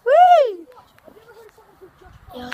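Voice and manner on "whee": high gleeful squeal; excited whee. Real, not acted. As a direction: squeal with joy. Swing ride exclamation.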